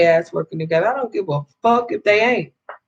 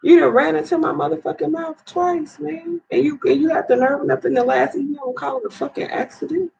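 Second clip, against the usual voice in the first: high-pitched, heated accusation; real fury, voice pushed up high; hurt and outraged, pitch climbing; furious rant; 7 semitones higher than usual. Real, not acted. Confronting the man who hit her.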